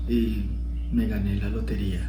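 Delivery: low, soft and lifeless; flat, deflated. Shown as a demonstration. Teacher demos a joyless lottery win, low and soft. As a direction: say it like it means nothing. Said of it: "No es lo mismo que te diga".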